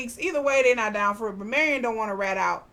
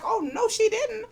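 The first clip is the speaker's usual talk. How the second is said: much higher, gasping oh no she didn't; excited disbelief; 13 semitones higher, 3 dB louder than usual. Real, not acted. Vlogger reacting to Miss Turner's threat, high excited disbelief. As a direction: react like the gossip just dropped. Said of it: "I'm like".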